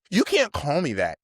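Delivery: high, loud retold objection; indignant protest. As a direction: act out an affronted outburst. Acted protest at a slur.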